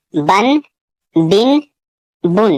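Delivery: بن repeated with a clear ending n; teacher repeating بن for the class; drilled syllable. Shown as a demonstration. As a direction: model بن one by one. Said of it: "تنوین میں بھی نون ساکن کی آواز آتی ہے".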